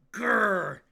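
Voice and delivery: high, loud spoken "grr"; hammed-up growl of rage; mock angry growl. Streamer playing mad Yuki, mock growl. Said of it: "I'm a mad Yuki Maeda".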